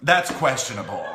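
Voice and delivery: ordinary delivery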